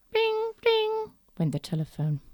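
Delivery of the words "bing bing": high chiming bing; high bell bing; high bing ring. Acted. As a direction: chime like a phone. Telephone sound.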